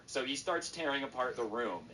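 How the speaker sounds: normal talking voice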